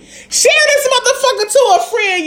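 high, excited push to share; hyped plea. Real, not acted.

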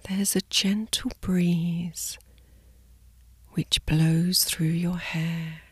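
soft slow sleep whisper; soft spa whisper. Acted. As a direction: whisper them gently to sleep. Guided sleep meditation body scan.

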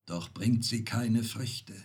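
usual speaking voice

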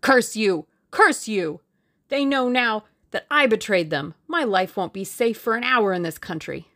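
loud, bitter cursing, easing off; vehement outburst. A narrator's reading. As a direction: burst out angrily at a captor. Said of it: "He burst out vehemently".

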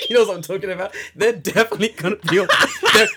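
loud, high, laugh-filled insistence; laughing excitement. Real, not acted. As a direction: insist on it while cracking up. Cracking up over gnome idea.

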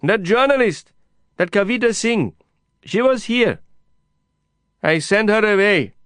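high, loud stubborn refusal; defiant shouting. A narrator's reading. Kasim rejects the journalist's help, defiant shout. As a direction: hold your ground at full voice.

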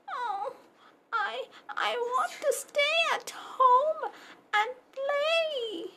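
high, whimpering puppy pleading; a scared little pup begging to stay home; tearful puppy. Acted. Puppy Pepper begs Mama not to send him to school, high and tearful.

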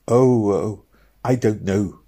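a mild startled cry; cried out. A narrator's reading. Meg startled by Robin's question about the devil, mild cry. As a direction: blurt it out, a bit flustered. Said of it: "cried Meg".

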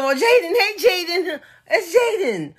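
high-pitched imitation of a smitten admirer; mock flirty fawning. Voicing grown woman fawning over Jaden.

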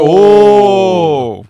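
hammed-up amazed "오", loud; mock ad wow. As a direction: gasp like a game show audience. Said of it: "옛날에 광고하는 것처럼".

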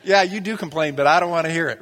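a teasing jab at a complainer; sarcastic retort. Retold or imitated. Preacher voicing his inner reply to complainers.

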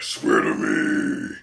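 very low, slow, loud growl; Batman. Retold or imitated. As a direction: growl a drawn-out threat far below your own voice. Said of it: "And Batman's like".

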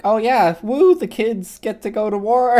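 high, slow mock celebration with a laugh; sarcastic cheer. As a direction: cheer it up with a sneer. Mocking the kids going to war.

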